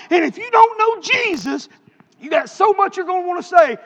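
high, loud, driving sermon delivery; full-force preaching. Real, not acted. Preacher pressing a congregation with his doorstep story, full-force preaching.

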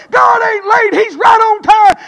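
loud, high, rejoicing testimony; joyful shouting. Retold or imitated. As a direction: shout the praise out. Retelling the joyful check news.

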